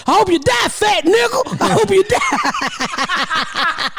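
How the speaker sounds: high taunting shout, last line drawn slow; mock shouting coworkers. Coworkers yelling out the window at him, high mock jeer.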